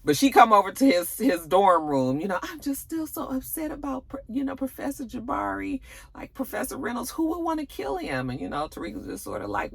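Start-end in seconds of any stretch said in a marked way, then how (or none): 2.4-8.3 put-on high soft girly voice; sweet, breathy-light young woman, much higher; girly classmate. Classmate fishing for comfort, high soft voice.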